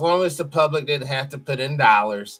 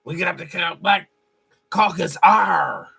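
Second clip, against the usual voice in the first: loud growling pirate "R"; 3 dB louder, slower than usual. Retold or imitated. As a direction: bark it like a cartoon pirate. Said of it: "like a pirate".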